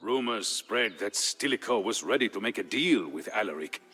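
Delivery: usual speaking voice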